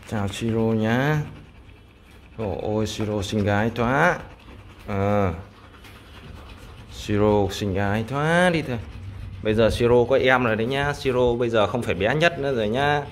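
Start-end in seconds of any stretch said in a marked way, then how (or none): 0.1-8.8 cooing. Real, not acted. Cooing at the dog.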